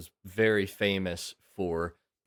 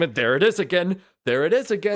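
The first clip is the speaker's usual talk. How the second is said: excited; 10 semitones higher, 9 dB louder, faster than usual. Pretend. Acting out startled audience.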